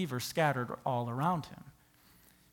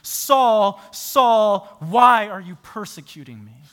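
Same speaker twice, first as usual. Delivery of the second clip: Jesus calling Saul; 4 semitones higher, 12 dB louder, slower than usual. Acted.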